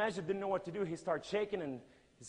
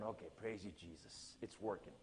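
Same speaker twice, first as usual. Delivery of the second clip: very soft, low thanks to God; private aside; 9 semitones lower, 15 dB quieter than usual. Retold or imitated. Preacher thanks Jesus as Majid asks questions, hushed aside. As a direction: say it under your breath.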